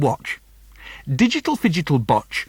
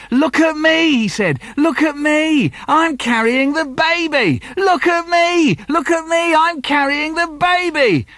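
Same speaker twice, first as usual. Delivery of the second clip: put-on high, loud boastful child voice; proud little boy; 12 semitones higher, 4 dB louder than usual. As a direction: show off like a three-year-old. Three-year-old Paul boasting.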